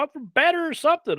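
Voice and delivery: high country twang; Southern country voice; country accent. Retold or imitated. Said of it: "he said his country accent".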